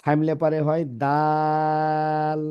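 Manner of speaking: দাল stretched slowly at the end; slow recited দাল; three-alif prolongation. Shown as a demonstration. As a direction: let দাল ring out long. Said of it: "যে হরফগুলো তিন আলিফ টেনে পড়তে হয়".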